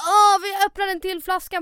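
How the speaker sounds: imitated eagerness. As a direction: imitate a guy pushing another bottle, loudly. Härmar killens ivriga förslag. (härmad högljudd iver)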